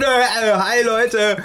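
high, hammed-up old geezer greeting; old man impression. Friend acting out an old man greeting the train passengers, high and hammed-up. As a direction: play a doddery old man saying hi.

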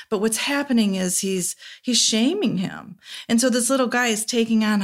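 usual speaking voice

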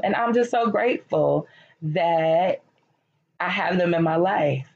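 ordinary talk

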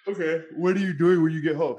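talking through a yawn. Real, not acted. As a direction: yawn while asking. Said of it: "Sorry, I'm yawning".

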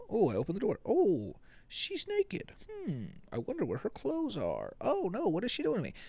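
high, squeaky mock innocence; mock naive student. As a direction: play the clueless boy in a silly high voice. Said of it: "It's not like you're going into this like".

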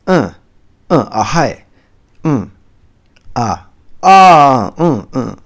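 demoing aizuchi listening noises; quick run of uh, ah, mm; Japanese listener backchannel. Shown as a demonstration. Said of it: "the listener is always".

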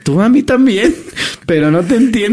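high laughing reply; giggling through the confused reply. Retold or imitated. Laughing while stoned with friend.

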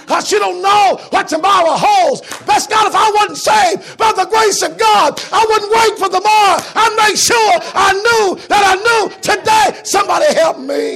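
high, roaring sermon shout; chanted, shouted pulpit climax; full-force preaching. Acted. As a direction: belt the story out at the top of your voice.

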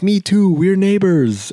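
hammed-up excitement, pitch soaring; delighted drunk man. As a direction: imitate overjoyed discovery, high and loud.

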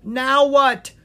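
high, loud cry of alarm. A narrator's reading. Prince with eyes shut asks the bard, crying out. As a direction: cry it out, wanting instructions. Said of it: "cried Lucas".

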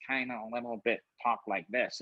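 lightly imitated odd way of talking; distinct voice impression. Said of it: "it was a very distinct voice".

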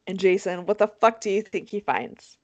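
usual speaking voice

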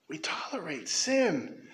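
hushed and slow; whispered. Real, not acted. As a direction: drop to a whisper on sin. Preacher whispers the accusation.

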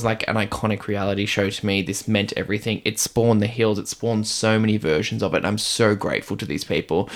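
ordinary talk

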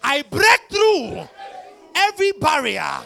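high, shouted, building preacher fervor; righteous paladin declaration. Acted.

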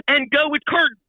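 high, energetic show call; called-out cue. Retold or imitated. Stage manager starting the show on time, called-out cue. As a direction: call the cue over a headset.

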